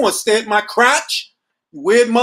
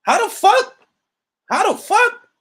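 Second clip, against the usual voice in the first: incredulous; 10 semitones higher than usual. Retold or imitated. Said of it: "'Cause I'm like".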